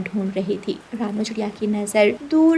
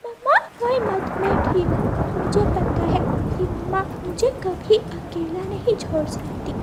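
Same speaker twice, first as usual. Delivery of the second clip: put-on higher child voice comforting mum; little chick; 9 semitones higher than usual. Chick comforting his crying mother, small child voice. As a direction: reassure mummy like a small child.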